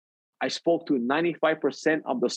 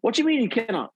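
high, disbelieving what you mean; mock outraged friend, pitched up; retelling a friend's disbelief; incredulous friend; 8 semitones higher than usual. Friend retold shocked on phone.

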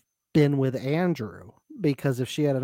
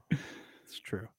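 whispered; 4 semitones lower, 14 dB quieter than usual. Real, not acted. Muttered agreement after joke.

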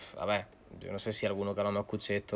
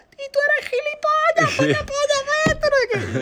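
friend screaming; 10 dB louder than usual. Retold or imitated. Friend Zara screams at him.

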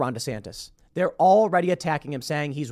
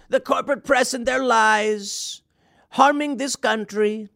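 higher, slow rally-speech imitation of Trump; Trump impression; 7 semitones higher, slower than usual. Imitating Trump on stage denouncing the corporate press, slow and higher. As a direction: ham up a slow, drawn-out Trump rally line. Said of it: "He's up on stage and he goes".

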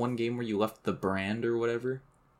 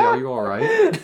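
squeaky high, louder mock "are you all right"; high mock concern; 10 dB louder than usual. Retelling the teammate's random high-pitched check-in to angry players. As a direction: pipe it up high out of nowhere. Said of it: "you just say".

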